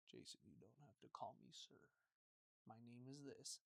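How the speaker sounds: very quiet, low dad voice; not screaming. Retold or imitated. Friend's dad correcting the visiting boy, quiet and low. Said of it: "only time I never heard him scream".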